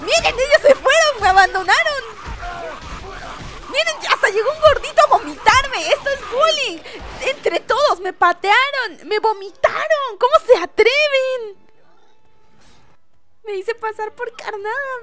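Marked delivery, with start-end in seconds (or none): 0.0-11.6 mock outrage. Real, not acted. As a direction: complain loudly like a wronged victim. Loud mock complaint to viewers.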